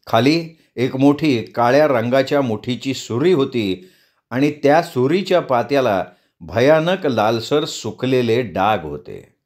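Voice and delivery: ordinary delivery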